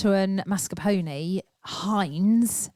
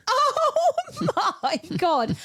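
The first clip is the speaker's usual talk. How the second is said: high, slow laughing oh my God; drawn-out laughing shock; laughing disbelief; much slower than usual. Retold or imitated. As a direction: squeal it out through a laugh. Shocked laugh at own lyric.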